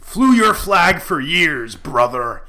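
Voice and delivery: loud wrestling promo rant. Acted. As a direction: cut a promo on Apple, brother. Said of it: "I'm gonna cut a promo".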